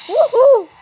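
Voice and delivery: loud, high whoop; cheer. Real, not acted. A player cheering her team's good move, loud whoop. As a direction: cheer the win.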